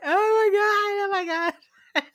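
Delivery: high, drawn-out mock wail; mock screaming and crying. Retelling a man screaming in the film, mock crying. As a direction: imitate a panicked, tearful cry. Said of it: "he's, like, screaming and crying".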